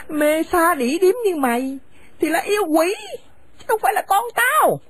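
harsh, contemptuous scolding of a daughter-in-law; bitter older woman driving her son's wife from the house; angry scolding mother. Acted. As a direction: spit the insults at her and order her out. Mother rejects son.

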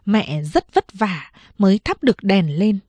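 usual speaking voice

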